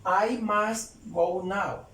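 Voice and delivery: "I must go now" stretched out slowly; very slow English demo. Shown as a demonstration. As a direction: drag out each word of "I must go now". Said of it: "Yo puedo decir con el más". ("I must go now" muy despacio, palabra por palabra)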